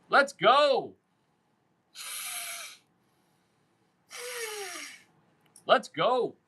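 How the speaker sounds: high, punchy rallying cry; hyped-up call. Real, not acted. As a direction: pump them up with a high "go".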